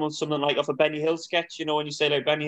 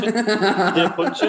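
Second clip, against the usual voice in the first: laughing; 5 semitones higher, 6 dB louder, much slower than usual. Real, not acted. Laughing at the crude joke.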